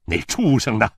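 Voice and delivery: loud, gruffly hostile question; angry demand. A narrator's reading. Father-in-law asks the mother for her son, angrily.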